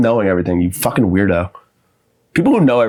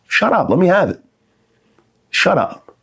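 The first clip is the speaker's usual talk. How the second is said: annoyed snap; 8 semitones higher than usual. Acted. Snapping at the know-it-all.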